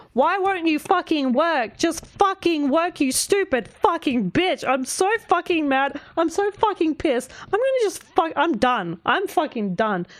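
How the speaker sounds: high-pitched mock rant at the computer; retold yelling. Creator reenacting yelling at the computer over the song, high mock fury. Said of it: "the yelling at the computer going".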